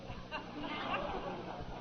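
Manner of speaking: short breathy high laugh. Real, not acted. Speaker laughs at the crocodile's reply, breathy laugh.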